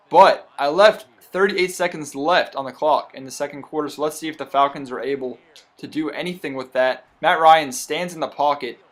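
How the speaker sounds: normal talking voice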